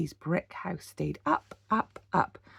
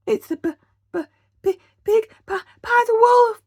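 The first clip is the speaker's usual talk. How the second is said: much higher, louder stammer; chattering with fear; 10 dB louder than usual. A narrator's reading. Boris stammers at the wolf's eyes, chattering with fear. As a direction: stutter in terror, rising. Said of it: "Boris chattered".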